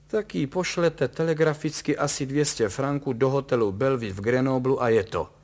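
loud, nervy know-it-all; nervous fussy little man. A narrator's reading. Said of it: "tiťarný a nervózní človíček".

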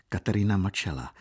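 plain reading voice

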